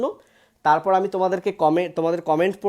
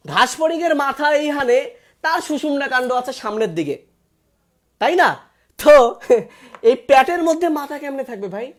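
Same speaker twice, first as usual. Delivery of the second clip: higher, louder exasperated explanation; exasperated explaining; 5 semitones higher, 4 dB louder than usual. Real, not acted. Teacher explaining to students why the answer is wrong, exasperated. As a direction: hammer it home, half laughing.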